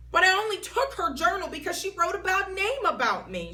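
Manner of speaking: high, loud defensive whine; whiny protest. Acted. May defending herself to the teacher, high and whiny. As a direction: argue it back.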